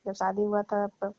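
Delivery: ordinary talk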